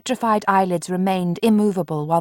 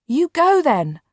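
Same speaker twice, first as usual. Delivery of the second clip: higher, louder push; urging; 8 semitones higher, 5 dB louder, slower than usual. A narrator's reading. Mark urging Marianne to go, higher and louder. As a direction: press her to go. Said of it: "Mark urged".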